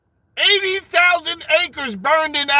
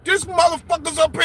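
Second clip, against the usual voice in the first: usual speaking voice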